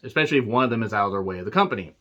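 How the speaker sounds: ordinary talk, nothing marked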